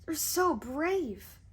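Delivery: slow lightly voiced adoring maiden; swooning maiden. A narrator's reading. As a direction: sigh it to your hero. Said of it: "she cried".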